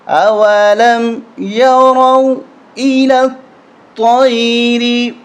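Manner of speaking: slow melodic tajweed recitation; Quranic recitation. Shown as a demonstration. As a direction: recite it slowly, drawn out, like a qari. Recites verse for students. (تلاوت کے انداز میں آہستہ)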